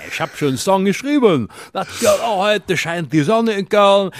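hammed-up happy Cologne local; mock cheerful Cologne. Comedian mocks Cologne cheer to his co-host, high singsong. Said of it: "Du musst auch wahnsinnig fröhlich sein".

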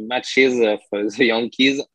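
ordinary talk, nothing marked

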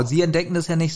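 normal talking voice

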